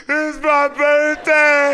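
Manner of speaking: very high, loud, stretched-out comic bawling; mock wail. Teen put in cop car, wailing inside, mock wail. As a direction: hold each word in a hammed-up wail. Same wail, supposedly tough outside.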